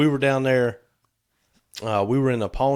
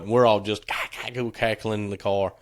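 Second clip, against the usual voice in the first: ordinary delivery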